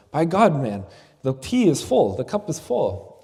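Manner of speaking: loud, high, shocked outburst at the spilling tea; retold British gentleman crying out in alarm; startled exclamation. British scholar watches his tea overflow, shocked loud exclamation.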